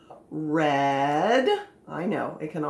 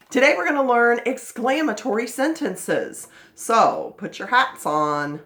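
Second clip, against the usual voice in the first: ordinary delivery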